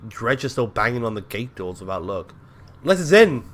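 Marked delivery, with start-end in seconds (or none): 2.8-3.5 high, loud call to be let in; shout. A narrator's reading. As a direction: shout it up at the gate. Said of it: "you shout".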